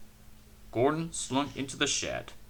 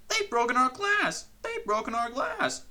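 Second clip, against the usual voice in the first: higher, wailing lament; sobbing coaches; 12 semitones higher than usual. A narrator's reading. Coaches lament broken windows, high mock sobbing. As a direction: wail over the glass. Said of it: "sobbed the coaches".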